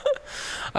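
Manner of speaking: brief soft chuckle; short chuckle. Real, not acted. Teacher amused by a student's comment, short soft chuckle. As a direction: let out a small, low-key laugh. Laughs after reading a comment.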